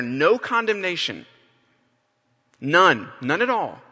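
"None" called out loud like a banner slogan. Shown as a demonstration. Preacher proclaiming the verse to the church as a loud banner statement. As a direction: proclaim "No condemnation" like words on a banner. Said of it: "this loud no".

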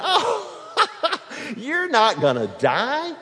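high, scoffing laugh through the words; mocking laughter with a slow, scornful dismissal; laughing serpent. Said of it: "throwing back his head and laughing".

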